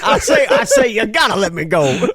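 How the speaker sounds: exaggerated southern plea through laughter; mock southern accent. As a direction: ham up a cartoon southern gentleman. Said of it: "thick Scottish accent".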